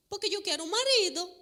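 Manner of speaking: put-on high, loud insisting little-girl voice; a child's high whining demand. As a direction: insist like a stubborn toddler. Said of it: "una niñita chiquita que llora".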